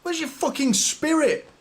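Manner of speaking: exasperated yell. Real, not acted. Fan pleading with his struggling team, exasperated yell. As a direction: throw it at the pitch in despair.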